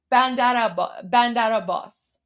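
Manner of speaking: "Bandar Abbas" with the R rolling straight into the A, "Bandara-bbas"; run together as one word. Shown as a demonstration. Showing viewers to blend the two words, smooth and linked. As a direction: let "Bandar" flow into "Abbas" with no break.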